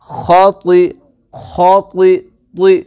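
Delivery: ती with a heavy, emphatic Arabic t; खती with thick, back-of-tongue ती; full-mouthed t. Shown as a demonstration. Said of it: "ती पुर पढ़ेंगे".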